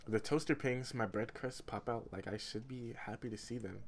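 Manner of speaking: plain reading voice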